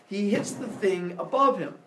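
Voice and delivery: usual speaking voice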